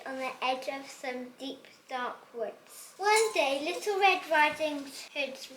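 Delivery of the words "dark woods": ordinary delivery, nothing marked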